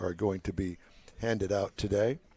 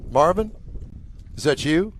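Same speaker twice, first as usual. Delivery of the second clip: calling out; 5 semitones higher than usual. Real, not acted. Calling to someone across the area.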